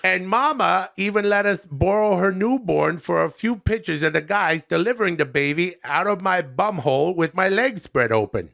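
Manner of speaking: lightly voiced chummy comic reviewer; folksy buddy-to-buddy review, a little put on; Adam Sandler.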